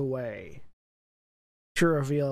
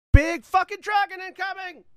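high, loud lookout shout; shouting from crow's nest; 8 dB louder than usual. Acted. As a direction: yell it down from the mast. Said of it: "from the crow's nest, you hear Pala".

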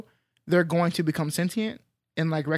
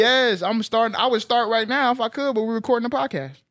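high, loud eager insistence; bursting to start now; excited; 6 semitones higher, 4 dB louder than usual. Real, not acted. Eager about rewatching.

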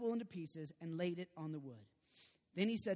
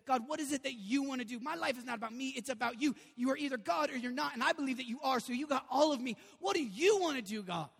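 raised, insistent praying voice; fervent prayer; 5 semitones higher, 5 dB louder than usual. Acted. Believer surrendering their life to God, fervent prayer. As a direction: pray it earnestly, building louder and higher.

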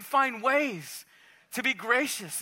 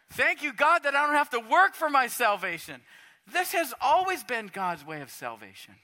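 usual speaking voice

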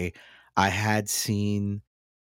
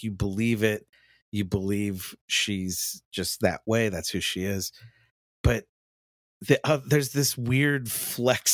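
usual speaking voice

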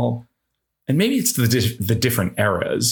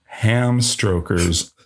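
ordinary delivery

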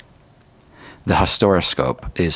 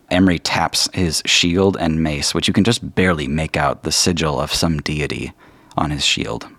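usual speaking voice

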